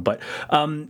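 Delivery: normal talking voice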